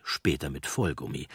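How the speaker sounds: usual speaking voice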